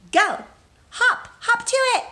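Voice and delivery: high, loud playful cheer; excited cheer. Acted. Teacher sending children to get crayons, high loud cheer. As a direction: send the kids off with a burst of energy.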